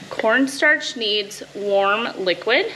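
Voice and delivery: ordinary delivery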